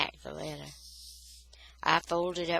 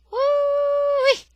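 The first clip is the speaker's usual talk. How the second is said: delighted whoop; 10 dB louder than usual. A narrator's reading. Daddy admires finished work.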